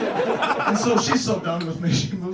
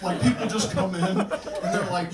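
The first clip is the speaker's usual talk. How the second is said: ordinary delivery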